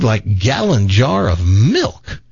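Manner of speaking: usual speaking voice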